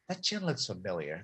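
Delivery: low, hushed aside to a buddy; under-the-breath suspicion; quiet aside. Acted. Imagined goon's muttered recognition.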